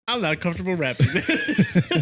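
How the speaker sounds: laughing quote. Retold or imitated. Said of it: "and I'm like".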